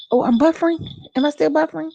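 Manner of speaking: ordinary talk, nothing marked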